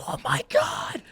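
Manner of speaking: high breathy gasp of shock; shocked whisper. Retold or imitated. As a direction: whisper it in disbelief. Screams down the hall.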